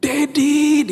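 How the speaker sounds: very high, held-out call; child's shriek. Retold or imitated. Child spotting the sold sticker calls to father, high shout. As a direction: squeal for daddy, imitating an excited child. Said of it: "dia teriak".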